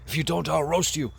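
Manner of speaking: high, loud comic threat; playfully menacing outburst; mock threat. Plea turns into threat to birds.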